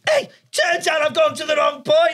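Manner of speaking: high, shouted mock Scouse; Scouse bus driver. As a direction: call out in an exaggerated Scouse accent. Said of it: "it's a Scouse bus driver".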